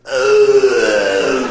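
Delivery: loud, drawn-out animal groan; seal bellow. Retold or imitated. Seal looking back through the plexiglass, loud bellow. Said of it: "and he's going".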